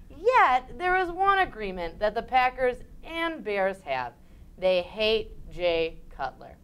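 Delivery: ordinary delivery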